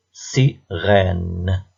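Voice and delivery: "sirène" broken into si, then a long rène; stretched-out "sirène" in two beats; split into syllables. Shown as a demonstration. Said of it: "puis écris le nombre de syllabes".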